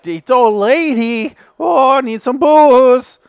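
slow, high, loud mock-drunk whine; hammed-up drunk with drawn-out words; Jerry Lewis drunk. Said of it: "He talks like Jerry Lewis, but he's trying to act drunk".

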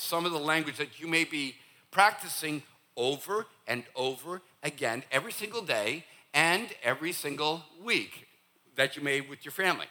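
normal talking voice

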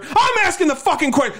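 high shouting drill sergeant. Retold or imitated. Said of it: "then he jumps to Texas".

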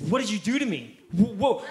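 loud, high startled cry; acting out a man in alarm; panicked guard. Guard to mom as his arm freezes, mock panic.

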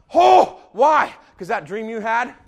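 normal talking voice

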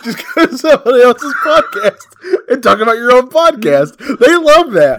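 high, loud, sarcastic and laughing; mock it through laughter. Real, not acted. Mocking self-promotion, laughing.

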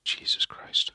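whispered. Real, not acted. Whispered reaction.

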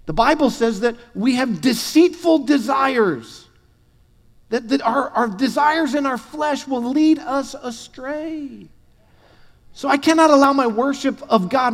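high, driving sermon voice; fervent preaching. Acted.

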